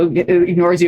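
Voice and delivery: ordinary talk, nothing marked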